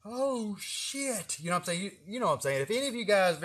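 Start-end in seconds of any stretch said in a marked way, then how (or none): 0.0-1.4 mild mock alarm. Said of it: "I'm like".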